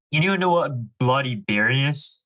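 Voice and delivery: ordinary talk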